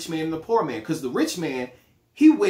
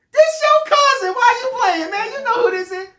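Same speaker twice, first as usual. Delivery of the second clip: much higher and louder, fake-friendly phone greeting; overeager cousin; 7 dB louder than usual. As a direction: gush like a long-lost cousin after money. Acted phone call, cousin caller.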